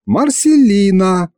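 high, drawn-out pout. A narrator's reading. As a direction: whine it slowly through pouting lips. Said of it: "надула губки Маша". (капризно, надув губки)

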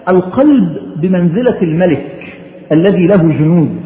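ordinary delivery

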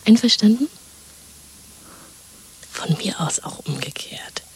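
conspiratorial whisper. Acted. Woman plotting escape with her lover: whisper. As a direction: plot it under your breath.

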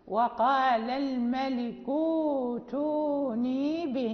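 ائتوني recited slowly, holding the long u; recited example. Shown as a demonstration. Teacher reciting slowly for the class. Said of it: "الساكنين".

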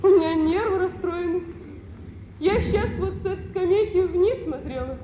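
rushed, trembling with fright after looking down; frightened, near tears. Acted. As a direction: voice wavering as if close to tears. Larisa frightened at the cliff. (испуганно, чуть не плача)

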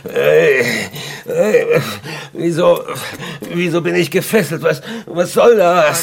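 frantic protest on waking bound; panicked. Acted. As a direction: shout in shock at being tied up. Wakes up tied, panics.

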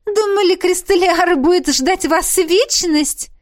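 high, loud, drawn-out silly teasing; silly giggly. A narrator's reading. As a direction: tease like a giggly coquette. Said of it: "глупо хихикнула она". (глупо-игриво, высоко и громко)

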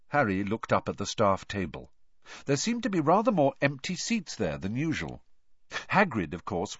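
plain reading voice

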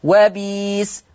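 وبيس with a long "ee" and no glottal catch; Warsh substitution. Shown as a demonstration. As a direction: draw out وبيس slowly as "wa-beees". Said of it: "تقرأ وبيس".

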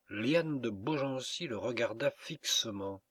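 ordinary delivery

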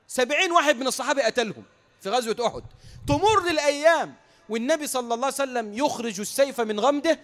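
forceful preacher, building to a loud climax; full-force preaching. Real, not acted. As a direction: proclaim it to a whole congregation.

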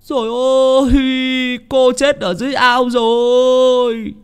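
high, long-drawn wail of alarm; horrified cry. A narrator's reading. Servant finds master's daughter dead in the pond, cries out in horror. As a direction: cry out loud, stretching the last word. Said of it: "ông kêu lên".

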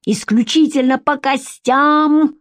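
high-pitched angry sarcasm, last words dragged out; enraged taunting. A narrator's reading. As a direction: hurl it as a scornful challenge. Enraged man taunting Vika.

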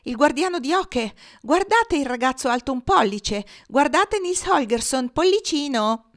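put-on high, jeering crowing over a tiny boy; mocking announcement. A narrator's reading. Poultry yard spots the thumb-sized boy, jeering call. Geese mock shrunken boy aloud.

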